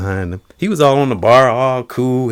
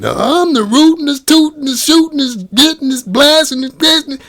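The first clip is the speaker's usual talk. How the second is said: much higher cartoon cowboy brag; Yosemite Sam. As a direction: crow each word like a cartoon gunslinger.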